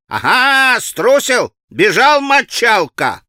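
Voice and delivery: high, loud, gloating taunt; jeering clamour. A narrator's reading. Boys mocking the fleeing child, loud jeering. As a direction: jeer after the runaway like a schoolboy. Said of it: "В группе загалдели".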